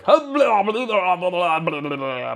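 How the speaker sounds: high, loud mocking blah blah; mock lecturer babble. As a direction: parody a droning examiner. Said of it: "He was like".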